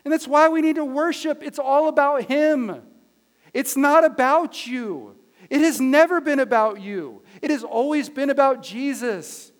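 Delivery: loud, high, emphatic preacher; impassioned preaching. Real, not acted. Pastor telling the church it's all about Jesus, preaching forcefully.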